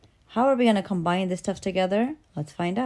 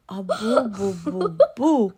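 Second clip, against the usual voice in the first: put-on monster echoing baby; 3 semitones higher, 5 dB louder, slower than usual. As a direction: echo the boos less forcefully, as the monster. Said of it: "And the monster's".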